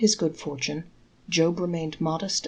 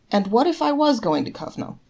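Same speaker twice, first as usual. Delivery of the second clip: curious; 5 semitones higher, 6 dB louder than usual. A narrator's reading. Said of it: "inquired the man curiously".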